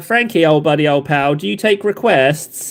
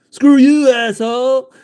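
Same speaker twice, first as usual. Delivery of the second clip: louder, higher mock shout; 6 semitones higher, 4 dB louder, slower than usual. Streamer acting a movie line, mock shout. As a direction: snap it out like a movie tough guy.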